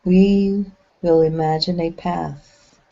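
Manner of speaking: measured, unhurried phrases, each left to settle; guided meditation. Acted. Leading the group along an imagined path in the clouds, guided meditation.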